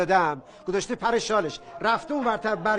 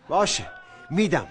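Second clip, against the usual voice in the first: ordinary talk, nothing marked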